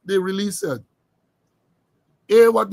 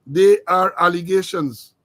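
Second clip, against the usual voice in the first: "They are allegations" slowly and deliberately stressed; emphatic repeat; 4 dB louder than usual. Real, not acted. Host reminding viewers nothing is proven, slow emphasis on "They are allegations". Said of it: "I'm emphasizing".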